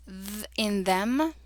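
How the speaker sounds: long buzzing voiced "th", as in "them"; hard TH. Shown as a demonstration. Teacher introducing the next special sound, hard voiced TH. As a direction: hold a voiced "th" alone, then say "in them". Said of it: "This is the hard T H sound".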